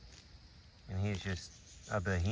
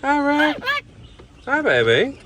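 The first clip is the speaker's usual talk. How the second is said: much higher, louder cooing greeting; 10 dB louder, slower than usual. Real, not acted. Keeper greeting parrot Rick, high cooing baby talk. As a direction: coax the parrot to talk back.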